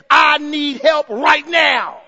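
loud, slow cry for help; urgent cry. Acted. Preacher voicing a desperate cry for help, urgent and loud. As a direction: cry out, landing heavily on each word. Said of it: "the kind of cry that says".

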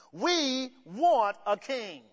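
slow, drawn-out mock demand for a king; mock crowd pleading for a king, stretched out slowly; whiny demanding people. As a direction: play a people grown tired of waiting, demanding. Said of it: "this is how they're gonna be talking".

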